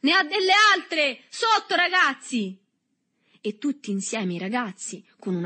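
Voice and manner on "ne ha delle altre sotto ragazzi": very high, loud yell; rally the gang to pile on; shouted rallying cry. A narrator's reading. Boy urges his gang onto Berto, shouting high. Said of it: "con un riso ottuso, gridò".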